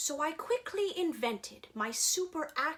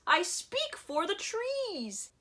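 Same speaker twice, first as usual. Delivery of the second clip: high, slow Lorax declaration; high slow Lorax plea; Lorax crying out; 9 semitones higher, slower than usual. A narrator's reading. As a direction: proclaim it slowly, pitched up. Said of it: "cried the Lorax".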